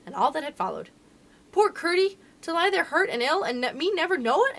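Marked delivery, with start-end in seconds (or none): 1.5-4.6 high, emphatic sympathy; pitying exclamation. A narrator's reading. Princess hearing of Curdie's hurt leg, exclaiming in pity. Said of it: "exclaimed the princess".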